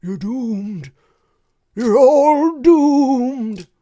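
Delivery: slow doom-laden prophet voice; slow ominous raspy drawl. Retold or imitated. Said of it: "Good old Ralph".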